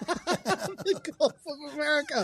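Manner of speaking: high, slow Midwestern mom voice; June. Acted. Mom character marvelling at directions, high and slow. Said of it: "That's what she would say".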